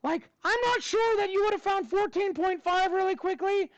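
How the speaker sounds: high, squeaky cartoon mouse voice held through the whole lesson; Mickey Mouse voice. Teacher quizzing his class on equations in a high Mickey Mouse voice. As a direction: teach the math steps as a cheerful cartoon mouse. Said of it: "I gotta do the voice too".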